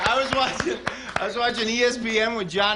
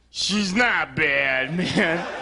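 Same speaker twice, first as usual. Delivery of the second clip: put-on very slow, lightly slurred drunk reply; mild drunk voice; drunk driver; 2 semitones lower, much slower than usual. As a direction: drawl it out like a tipsy guy. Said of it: "face to face with a lady".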